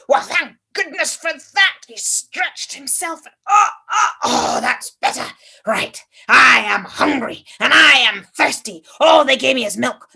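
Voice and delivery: put-on loud, complaining old fellow; grumpy old man. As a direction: grumble loudly like a cranky old man. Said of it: "a little old man".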